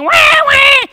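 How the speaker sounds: high, loud mock baby cry; imitated baby wail. Father mimics his baby crying at night, high loud wailing. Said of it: "'cause I could hear".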